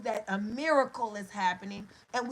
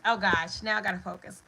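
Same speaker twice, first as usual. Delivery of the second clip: ordinary delivery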